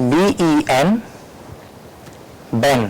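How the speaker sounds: letters one by one, then the syllable "বেন"; spelled-out syllable. Shown as a demonstration. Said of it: "কিরকম করে এটাকে ভাগ করা হচ্ছে". ("বি ই এন" বানান করে, থেমে "বেন")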